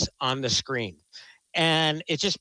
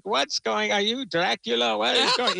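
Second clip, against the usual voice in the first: imitating a weird, higher put-on movie accent; mock the odd accent Tom Hanks uses as Colonel Tom; Tom Hanks' strange accent; 7 semitones higher than usual. Mocking Tom Hanks' Colonel Tom accent to the host, higher odd accent. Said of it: "he's got this accent where it's like".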